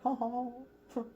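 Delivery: high-pitched gloating singsong; playful, high villainous gloat; mock taunting. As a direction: tease like a smug rival, breaking into laughter. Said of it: "just taunting him".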